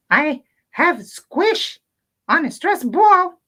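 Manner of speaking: high puppet voice, upset about his hat then calming; Pedrito puppet voice. Acted. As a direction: play the grumpy little puppet who calms down. Puppet Pedrito talks to children.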